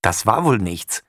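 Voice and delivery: usual speaking voice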